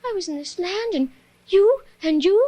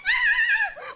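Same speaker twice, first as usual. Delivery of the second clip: high scream. Acted. Scream in parody.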